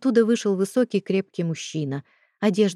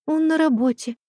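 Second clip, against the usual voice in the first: put-on much higher, timid girl; 9 semitones higher than usual. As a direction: answer in a small scared voice. Frightened girl's answer.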